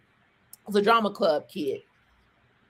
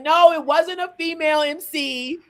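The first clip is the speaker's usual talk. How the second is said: lightly voiced friends, higher; higher teasing imitation of the crowd; imitating everybody; 7 semitones higher than usual. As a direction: quote the crowd in a higher mock voice. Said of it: "everybody's like".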